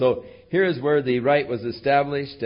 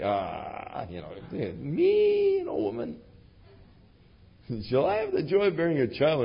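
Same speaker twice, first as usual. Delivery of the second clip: scoffing disbelief; 5 semitones higher than usual. Retold or imitated. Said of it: "hers was a laugh of incredulity".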